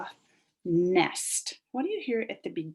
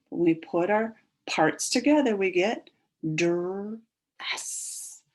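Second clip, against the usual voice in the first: ordinary delivery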